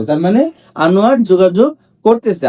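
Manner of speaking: normal talking voice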